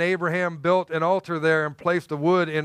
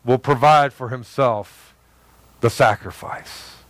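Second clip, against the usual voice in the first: slow, low and steady assurance; calm reassuring Abraham; 5 semitones lower, slower than usual. Retold or imitated. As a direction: lower and slower, a father calmly reassuring his son. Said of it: "when he told his son".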